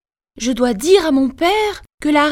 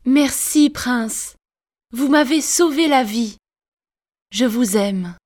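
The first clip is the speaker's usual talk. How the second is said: tender thanks; 2 semitones lower than usual. Acted. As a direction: thank him gently, in love.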